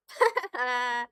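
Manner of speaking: short laugh at your own joke; laugh, then let it trail out. Real, not acted.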